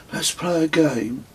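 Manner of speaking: lightly voiced young boy pitching a game; child's voice. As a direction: voice a bored nine-year-old son. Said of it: "said Kez".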